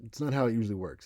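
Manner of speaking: ordinary talk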